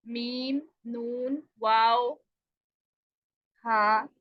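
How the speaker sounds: normal talking voice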